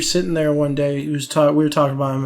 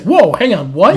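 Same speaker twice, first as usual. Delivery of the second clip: higher, louder startled quote; mock alarm; 5 semitones higher, 7 dB louder than usual. Bar back hearing about killing people, mock alarm. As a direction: imitate someone taken aback, rising at the end.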